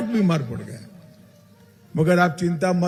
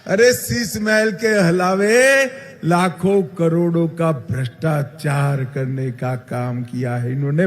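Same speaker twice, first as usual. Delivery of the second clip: normal talking voice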